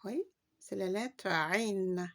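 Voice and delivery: ordinary talk, nothing marked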